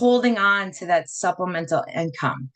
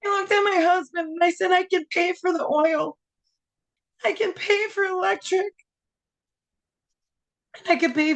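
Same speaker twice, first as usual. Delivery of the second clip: high, tearful voice through the whole story; telling it while crying happy tears; emotional, on the edge of sobbing; tearful retelling; 6 semitones higher than usual. Real, not acted. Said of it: "I'm gonna start crying".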